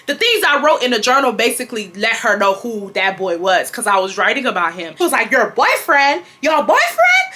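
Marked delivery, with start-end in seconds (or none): 5.3-7.2 high, rising incredulous mom voice; hammed-up outraged questioning; mock screaming. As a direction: squawk it as a scandalized mother. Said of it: "she was screaming".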